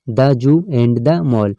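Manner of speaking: sentence read out slowly, word by word; slow reading aloud. Shown as a demonstration. Teacher reads the example sentence slowly to students. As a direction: dictate it slowly to the class. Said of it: "teacher reads example sentence".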